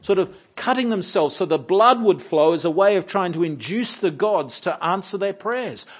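normal talking voice

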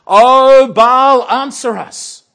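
high, very loud frantic plea; a crazed shouted prayer; frenzied cry. Pretend. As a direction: howl in a frenzy to Baal. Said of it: "the frenzy that they are in".

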